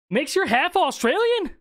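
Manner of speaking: surprised question. Real, not acted. Streamer asking a chatter about being half Australian, surprised.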